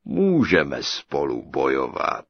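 slow, deep, loud giant voice; booming giant. A narrator's reading. The giant greeting his captive bride, booming. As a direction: boom it out like a huge ogre.

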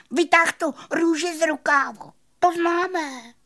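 very high, loud cricket voice; squeaking cricket. A narrator's reading. Cricket exposing the magician's trick, high squeaky scoffing. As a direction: squeak it dismissively. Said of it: "kníkl Cvrček".

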